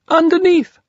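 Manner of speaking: underneath sort of way. Acted. Piglet answering from underneath Pooh, small high voice. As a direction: squeak it from down below. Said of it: "in an underneath sort of way".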